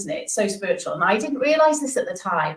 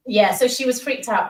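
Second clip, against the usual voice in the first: normal talking voice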